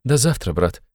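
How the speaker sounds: high, pained farewell; compassionate. A narrator's reading. Sister takes leave of her brother, compassionately. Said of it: "со страданием сказала Дуня".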